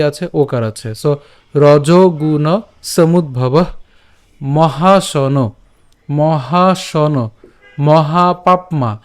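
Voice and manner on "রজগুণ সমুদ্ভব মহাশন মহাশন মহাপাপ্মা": মহাপাপ্মা said very slowly, pap then ma; careful syllables. Shown as a demonstration. Teacher drills the verse words for students. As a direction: drag out মহাশন and মহাপাপ্মা syllable by syllable. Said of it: "এখানে আমরা উচ্চারণ করলাম পাপ্মা".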